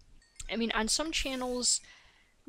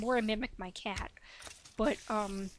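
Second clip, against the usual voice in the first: normal talking voice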